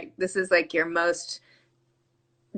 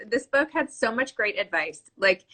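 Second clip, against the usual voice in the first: ordinary delivery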